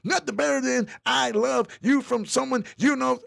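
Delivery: high, loud mock yell reading the comment; reading all caps as yelling. Shown as a demonstration. Reading Kelly's all-caps comment as a mock yell. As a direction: shout it like it's written in all caps. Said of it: "I'm gonna read this. How you wrote it".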